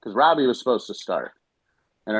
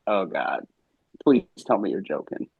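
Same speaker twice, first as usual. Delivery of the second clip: lower, mildly dismayed quote; retold dismay; 3 semitones lower than usual. Coach recalls hearing his pitcher can't go, mild dismay. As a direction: recall pleading with a sinking stomach. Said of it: "like, sick to my stomach".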